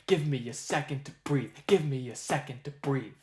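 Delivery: "Give me a second to breathe" chanted in threes and two; tresillo rhythm. Shown as a demonstration. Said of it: "create a lyric that matches that flow".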